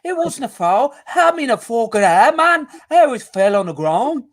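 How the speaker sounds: high, hammed-up Scouse accent, pitched well up; mock a player in a terrible Scouse accent; a clumsy, overdone Scouse accent; bad Scouse accent. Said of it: "That's a l-, terrible Scouse accent".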